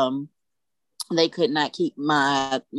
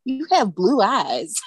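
tender wonder; 6 semitones higher, slower than usual. Retold or imitated. Mother marvels at newborn son.

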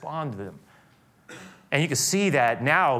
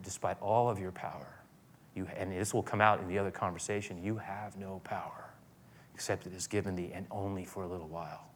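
quiet calm Jesus; 5 semitones lower, 9 dB quieter than usual. Acted. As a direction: let it fall soft and steady, unafraid. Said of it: "basically said".

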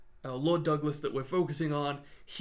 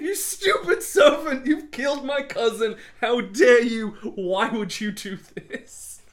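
much higher, loud hammed-up rage through laughter; mock furious yelling; 8 semitones higher, 8 dB louder than usual. Ramsay beats Archibald with the boot, mock furious yelling. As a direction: rant while beating him. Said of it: "He's like".

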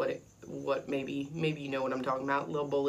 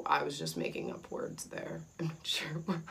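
quiet embarrassed aside; 6 dB quieter than usual. Real, not acted. Laughing at own slip.

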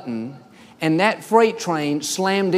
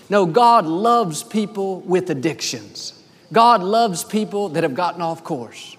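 ordinary talk, nothing marked

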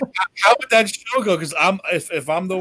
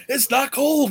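Hogan impression; 10 semitones higher, much slower than usual. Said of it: "as Hogan".

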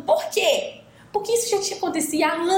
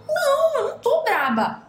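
mild mock denial; 11 semitones higher than usual. Girlfriend denying being angry.

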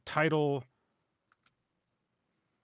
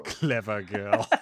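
movie quote voice; 3 dB louder, much slower than usual. Retold or imitated. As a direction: savour the words like a stalking hunter.

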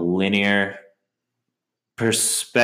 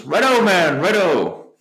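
higher put-on voice, drawn out; mock-cheerful film voice, pitched up; imitated Duke; 11 semitones higher, 3 dB louder, slower than usual. Imitating Duke driving away.

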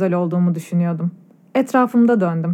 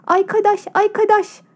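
put-on much higher, garbled little girl's cry; excited child shouting; 10 semitones higher than usual. A narrator's reading. A girl hugs her new friend, shouting happily in a high lisp. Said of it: "diye bağırıyordu".

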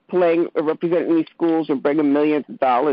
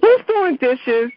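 calling out; 7 semitones higher, 4 dB louder, slower than usual. Real, not acted. Calling out over background noise.

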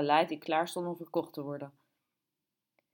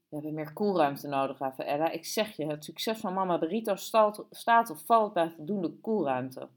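usual speaking voice

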